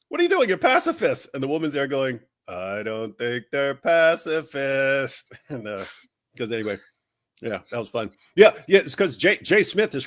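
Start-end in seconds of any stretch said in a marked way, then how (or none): 0.1-1.3 much higher mock outraged squeal; indignant outrage. Slapped debater protesting at Christians, voiced high and outraged. 2.5-5.3 slow, dry understated comeback; deadpan retort. Retold or imitated. Woman answering the protester with slow deadpan.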